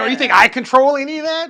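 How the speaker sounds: loud, high, laughing scoff; amused, incredulous mock reply; mocking disbelief. Entity's mocking reply, acted out.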